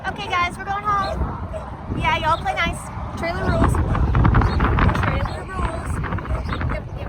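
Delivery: high, loud cheerful pet voice; talking to a horse. Real, not acted. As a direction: coax the animals warmly along. Unloading horses, coaxing them home.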